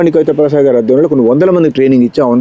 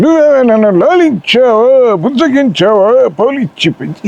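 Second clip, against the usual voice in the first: much higher, grand anguished plea to mother; NTR as Karna; 10 semitones higher, slower than usual. Shown as a demonstration. Karna demands of his mother, in booming NTR voice. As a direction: cry out to your mother like a wronged hero. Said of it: "Ramarao గారు కర్ణుడిగా చెప్పే".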